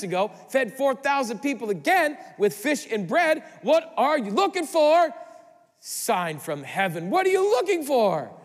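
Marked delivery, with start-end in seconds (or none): 3.6-5.1 loud, much higher demand; exasperated challenge. Acted. Preacher voicing Jesus' frustration, loud exasperated demand. Pastor challenges Pharisees rhetorically. 7.1-8.3 loud, much higher demand; exasperated challenge. Acted. Preacher voicing Jesus' frustration, loud exasperated demand.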